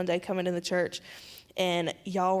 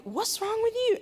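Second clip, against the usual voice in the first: much higher, exasperated mock rebuke; retold exasperated scolding. Scolding Brittany at the altar, much higher exasperated rebuke. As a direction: fling the question at her, fed up. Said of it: "And I was like".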